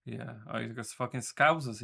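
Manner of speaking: ordinary talk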